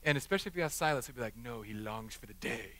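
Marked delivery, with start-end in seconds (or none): 1.4-2.7 low, soft, slow and grave; hushed, drawn-out mock solemnity; solemn quiet answer. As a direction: imitate a child's deadpan, longing reply. Son's solemn answer, acted.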